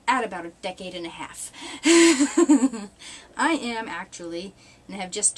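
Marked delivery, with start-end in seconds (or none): 1.6-3.3 high, loud burst of laughter. Real, not acted. Laughs about looking young.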